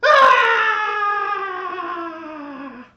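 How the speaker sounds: piercing high screaming wail; latke scream. Acted. Latke running down the boulevard, screaming. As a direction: shriek as it runs. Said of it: "run screaming".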